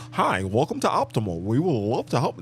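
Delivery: sing-song customer-service parody; imitating an overly proper operator greeting; mock call-center voice. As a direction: bounce the pitch like a scripted phone agent. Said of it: "when you start talking like that".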